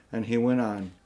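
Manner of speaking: usual speaking voice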